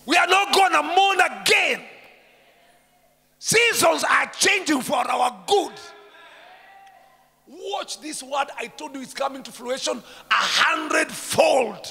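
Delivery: loud high declaration; triumphant preaching. Acted. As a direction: proclaim victory.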